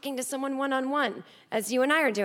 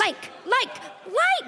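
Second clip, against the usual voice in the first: each like higher than the last, ending in a high yelp; building excitement; 10 semitones higher, much slower than usual. Acted. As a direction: ramp up the clicking like a giddy child. Karen poking John's face.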